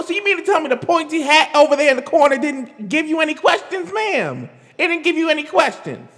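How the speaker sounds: scolding sarcasm at the woman; sarcastic scolding. Real, not acted. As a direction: grill her with sarcasm.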